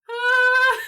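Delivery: sudden high, loud shriek of alarm; a short panicked squeal; startled yelp. Retold or imitated. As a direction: yelp as if caught out. Startled cry at dad.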